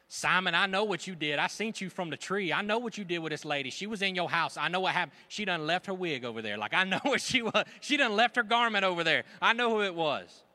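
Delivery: loud, high, knowing accusation; loud call-out. Retold or imitated. Jesus confronting Simon the host, loud and knowing. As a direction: lay it on him loudly.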